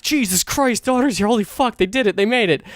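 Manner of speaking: high, thrilled fan freakout; marking out. Retold or imitated. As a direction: re-enact losing it as your favorite band appears. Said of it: "fucking marking out".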